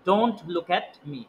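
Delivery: very slow, word by word "Don't look at me"; slow model sentence. Shown as a demonstration. Teacher slowly modelling an English sentence, very slow. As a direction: spell it out slowly for the class. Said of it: "এগুলা শুনতে শুনতে আস্তে আস্তে উচ্চারণ ঠিক হবে".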